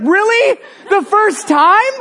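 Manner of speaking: high, rising disbelief, drawn out at the end; incredulous. Retold or imitated. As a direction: squeak up in comic disbelief. Leader incredulous at girl's claim.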